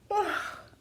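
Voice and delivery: high, long yawning sigh; big yawn sigh. Acted. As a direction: stretch into a loud yawn. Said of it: "Should we sing a song".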